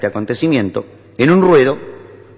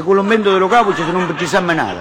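put-on high, eager Italian-accented pitch; Italian husband; 6 semitones higher than usual. Husband telling wife of gaucho invention, Italian-accented eagerness. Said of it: "Y la llama a la mujer".

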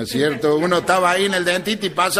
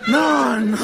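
incredulous no; 8 semitones higher than usual. Retold or imitated. Doctor incrédulo.

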